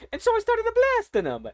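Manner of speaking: the line redone way up high in a cartoon voice. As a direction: replay it as the gleeful little hero. Same line redone in character voice.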